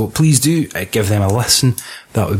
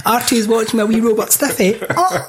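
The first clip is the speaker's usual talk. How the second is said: very high, daft voice ending in a squeaked oh; pitched-up comic spoiler voice; silly character voice; 9 semitones higher than usual. Pretend. Host inventing rude film spoilers, high silly voice.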